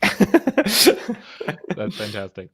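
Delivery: slow, loud, laughing "That's fantastic"; drawn-out laughing delight. Real, not acted. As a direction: stretch "That's fantastic" out slowly through a laugh. Laughing reaction to Polish accent joke.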